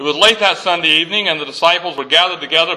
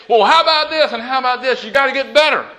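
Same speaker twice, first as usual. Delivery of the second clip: high-pitched, insistent fault-finding; mock nagging; 6 semitones higher, faster than usual. Nagging someone who is trying to improve, high and insistent. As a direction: pick at them, voice raised in pitch. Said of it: "not to knock them down and say".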